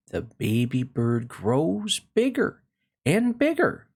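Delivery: gentle storybook read-aloud; lively picture book reading; reading to children. Acted. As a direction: read it like bedtime story time.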